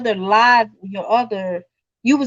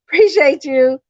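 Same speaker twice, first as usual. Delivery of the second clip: higher and louder, through a laugh; giggling appreciation; 5 semitones higher, 5 dB louder than usual. Real, not acted. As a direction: laugh out the thanks. Laughing repeat of thanks.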